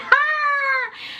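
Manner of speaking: long, very high excited squeal. Real, not acted. Storyteller thrilled about a second location, high squeal. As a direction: squeal on one held high note.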